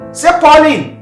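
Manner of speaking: father pushing daughter to say her new name; insistent. Shown as a demonstration. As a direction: press her hard to say Pauline. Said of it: "Say it".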